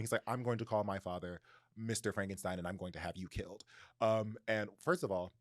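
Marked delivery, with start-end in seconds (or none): none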